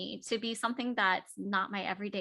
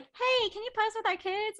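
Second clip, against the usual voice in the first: parent asking; 12 semitones higher than usual. Retold or imitated. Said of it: "someone's like".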